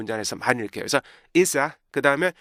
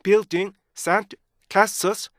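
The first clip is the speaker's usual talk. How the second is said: very slow, higher, word by word; cut into chunks; 6 semitones higher, much slower than usual. Shown as a demonstration. Teacher showing students the breaks, slow and choppy. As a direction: separate each word with a clear stop. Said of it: "원래 이렇게 끊어진 거예요".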